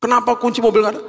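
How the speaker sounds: high, rapid grumbling question; annoyed. Retold or imitated. Pastor recalls fretting over missing car keys, fast and annoyed. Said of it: "Karena saya kesel awalnya".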